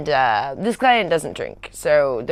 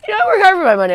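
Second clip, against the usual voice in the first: much higher, louder mock indignation; retold outrage, pitched way up; indignant; 7 dB louder, much faster than usual. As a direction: put your foot down over a price. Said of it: "And I was like".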